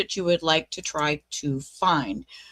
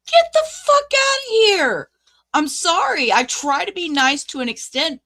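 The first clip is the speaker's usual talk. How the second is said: furious outburst; 7 semitones higher, 5 dB louder than usual. Real, not acted. Furious rant about the immunity request.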